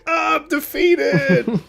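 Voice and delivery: mock defeat laughing. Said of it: "And he's like".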